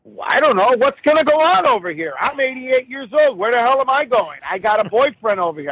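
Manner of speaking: high, indignant mock mother; cranky old mother. Eighty-eight-year-old mother answering her son, high cranky voice. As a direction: imitate an elderly mother griping back. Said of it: "she doesn't hear you".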